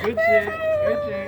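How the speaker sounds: drawn-out strained high shout. Real, not acted. Friend cries out high and strained after her first hard liquor shot. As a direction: force it out high and slow after the burning shot.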